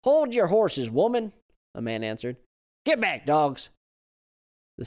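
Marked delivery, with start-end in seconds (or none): 0.0-1.3 put-on raised, loud Southern old man. A narrator's reading. Old man voiced in story. 2.9-3.7 put-on loud, raised old man bark; shouting at dogs. A narrator's reading.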